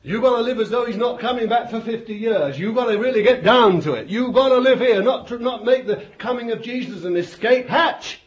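fast, high, loud hammering appeal; urgent exhortation. Real, not acted. Preacher warning believers not to wait for Jesus, urgently. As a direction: drive the point home.

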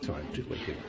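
quiet, low, teasing jeer; a sly, quiet jeer at Baal's sleeping god; mocking. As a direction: needle the false prophets under your breath. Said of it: "Old Elijah mocked and said".

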